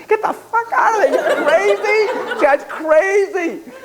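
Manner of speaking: very high, panicked squeal; hammed-up terror with laughter in it; inner terror. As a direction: shriek like your own panicked inner voice. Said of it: "the inner terror, my, my protector was like".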